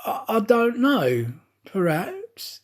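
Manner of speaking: embarrassed stammer. A narrator's reading. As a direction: stumble over it, mildly embarrassed. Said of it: "a little embarrassed".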